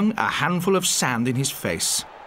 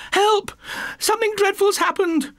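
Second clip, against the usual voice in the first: very high, frantic cry for help; panicked shout for help. Acted. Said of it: "she was shouting".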